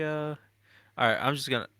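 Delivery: ordinary talk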